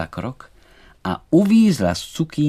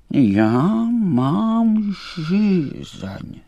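high, very drawn-out whine; slow, high insistent complaint; thirsty butterfly; 9 semitones higher, 4 dB louder, much slower than usual. A narrator's reading. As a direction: stretch every word in a whining demand. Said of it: "řekl Emanuel".

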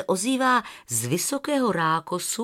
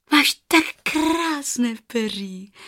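put-on slow, higher little-girl voice; 4 semitones higher, slower than usual. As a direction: admire the goose shyly. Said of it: "řekla Rozárka". (holčička obdivuje husu)

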